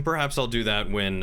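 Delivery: ordinary talk, nothing marked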